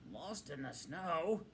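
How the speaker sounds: slow, surprised Badger question; Badger exclaiming. A narrator's reading. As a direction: let Badger's surprise stretch out the words. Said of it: "exclaimed the Badger".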